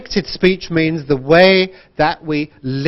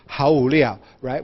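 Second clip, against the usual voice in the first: ordinary talk, nothing marked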